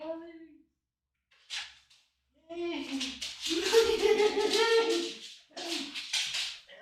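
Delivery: very high sing-song voice for the dog, called from across the room; high-pitched pet talk to the dog away from the mic. Real, not acted. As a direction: coo at a naughty puppy, pitch way up. Streamer talking to his dog.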